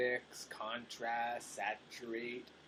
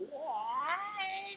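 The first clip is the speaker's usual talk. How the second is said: much higher, squeaky Australian "Why"; hammed-up Australian vowel, pitched way up; mock Australian accent. Streamer failing at an Australian accent, mock Australian accent. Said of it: "I can't even do Australian".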